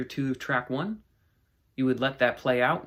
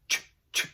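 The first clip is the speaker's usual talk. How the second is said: beatboxed ch sounds. Shown as a demonstration. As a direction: tick out separate percussive ch sounds. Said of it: "They're just ch sounds".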